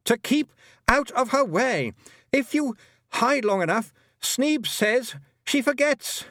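put-on high, urgent boy's voice; anxious boy. A narrator's reading. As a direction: voice a boy calling out, pitched high.

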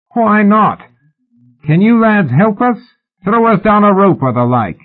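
high, loud hail; high-pitched shouted request; calling up. A narrator's reading. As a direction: holler up for help. Mallory calls up for a rope.

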